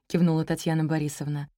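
ordinary delivery

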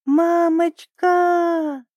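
high, very slow drawn-out call; crying out. A narrator's reading. Little penguin calls to mother as he drifts away, crying out. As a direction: hold each vowel, calling from far away. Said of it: "закричал Пик так громко".

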